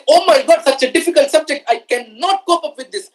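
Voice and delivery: mock student groaning over a hard book; mock despair. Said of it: "you open your book and you say".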